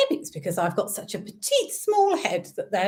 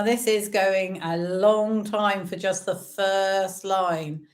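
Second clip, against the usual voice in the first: usual speaking voice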